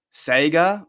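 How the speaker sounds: Sega said "SAY-ga", as a questioning echo; other people's pronunciation. Retold or imitated. Host echoing the listeners' way of saying it, disbelieving SAY-ga for Sega. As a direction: say Sega with an AY vowel, rising. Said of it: "dude, that's terrible".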